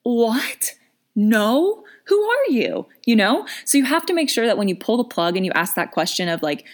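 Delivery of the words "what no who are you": much higher, baffled pushback; high startled what-no-who-are-you; shocked disbelief. Pretend. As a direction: recoil from a creepy stranger. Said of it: "You'd be like".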